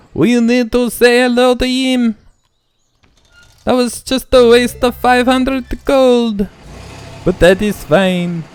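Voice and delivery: put-on high, loud, over-the-top Lumiere-style accent; Lumiere-esque accent. Player reacting to Pierre's birthday, hammy Lumiere-esque accent. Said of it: "I don't know about that accent".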